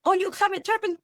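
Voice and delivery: mock-surprised client, high and light. Client surprised that the therapist has one, mock-surprised and high. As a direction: play the startled client, a touch hammed-up. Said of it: "and then".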